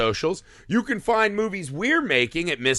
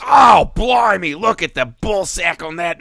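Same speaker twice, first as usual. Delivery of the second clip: Steve Irwin impression; 5 semitones higher, 6 dB louder than usual. Host attempts Australian accent for co-host, excited Australian shout. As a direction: exclaim in an Australian accent like a thrilled wildlife host. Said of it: "That was like Steve Irwin".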